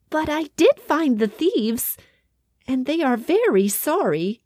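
politely explaining as a small mouse; little mouse. A narrator's reading. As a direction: mouse pleading her case to the king. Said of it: "said the little mouse".

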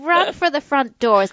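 ordinary delivery

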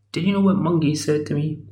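high, put-on old woman voice telling tales; tattling old woman. Old woman reporting Monkey to Tripitaka, high put-on tattle. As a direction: tell on him like a wounded old lady.

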